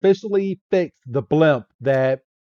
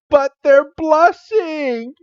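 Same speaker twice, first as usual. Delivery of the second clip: much higher, slow singsong tease; high teasing Jessie; 13 semitones higher, slower than usual. Retold or imitated. Jessie mocking the blushing kids, high slow tease. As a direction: sing it up high to mock them.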